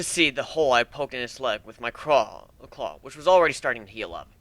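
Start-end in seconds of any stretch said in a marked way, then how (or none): none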